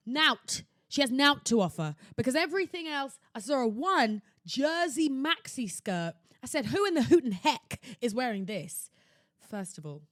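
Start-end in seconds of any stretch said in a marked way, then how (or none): 0.1-5.1 much louder, exasperated complaint; nout, nought, louder and fed up; loud rant. Real, not acted. Ranting about the store. 6.7-8.9 loud mock outrage. Outraged quote about skirt.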